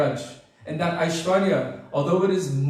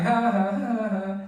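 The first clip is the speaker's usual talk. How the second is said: mock teasing laugh; 4 semitones higher, slower than usual. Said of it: "all the boys were laughing".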